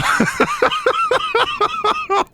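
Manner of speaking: long high cackling fit; can't stop laughing; helpless laughter. Real, not acted. Player losing it at the randomizer, helpless laughter.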